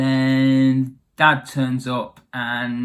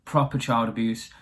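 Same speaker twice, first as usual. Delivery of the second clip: ordinary talk, nothing marked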